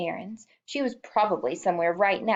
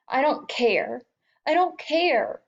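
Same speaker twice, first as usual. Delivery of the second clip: crying; 7 semitones higher, slower than usual. A narrator's reading. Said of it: "He was crying now".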